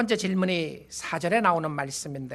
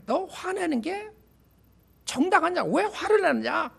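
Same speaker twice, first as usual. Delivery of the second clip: put-on high, stern parent questioning a child; parent scolding; 9 semitones higher than usual. Parent confronting an angry child, stern and high. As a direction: scold the sulking child from above.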